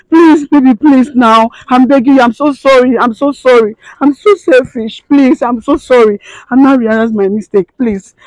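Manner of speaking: high, loud tearful apology; remorseful sobbing. Acted. Wife begging her dead husband's forgiveness, sobbing apology. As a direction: beg forgiveness through sobs.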